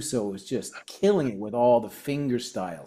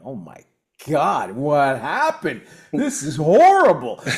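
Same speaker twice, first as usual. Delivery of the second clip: dismayed; 3 semitones higher, 8 dB louder than usual. Retold or imitated. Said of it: "I'm like".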